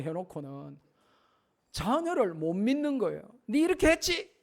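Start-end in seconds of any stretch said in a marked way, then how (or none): 3.5-4.3 high, suspicious parent's question; accusing parent. Retold or imitated. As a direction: needle the child with a pitched-up accusation.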